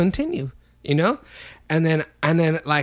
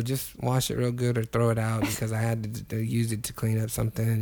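softer, sheepish retold advice; quietly owning up; sheepish quiet confession; 7 semitones lower, 9 dB quieter than usual. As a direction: say it low, a bit guilty. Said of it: "I go".

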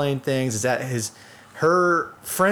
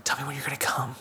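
breathy retold whisper; 8 dB quieter, faster than usual. Friend retelling the movie scene, whispered. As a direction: whisper it like a lover. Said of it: "she whispers and she says".

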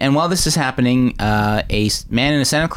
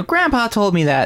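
put-on little boy; 9 semitones higher than usual. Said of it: "you know".

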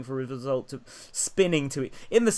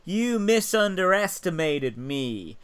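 slow, higher-pitched mock presidential drawl; George W. Bush; 6 semitones higher, 3 dB louder, slower than usual. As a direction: imitate a word-mangling American politician, drawn out. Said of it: "he says words wrong".

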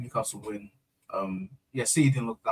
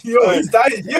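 laughing; 9 semitones higher, 7 dB louder than usual. Real, not acted. Laughing hard at the bit.

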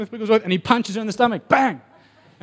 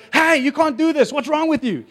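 crowd protest; 9 semitones higher than usual. Retold or imitated. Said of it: "they go".

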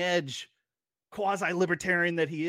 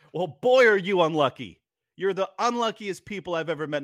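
loud, higher-pitched mock amazement; 4 semitones higher, 5 dB louder than usual. Real, not acted. Host throwing the luck claim back, mocking. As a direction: jeer it loudly at your opponents.

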